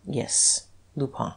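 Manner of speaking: very soft, hushed confirmation; secretive. A narrator's reading. Detective confirming the secret to the Duke, hushed. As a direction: barely voice it, confidentially. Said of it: "Guerchard lowered his voice".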